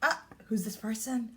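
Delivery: fast, breathy whisper; hushed riddle to the class. Acted. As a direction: whisper it like a secret.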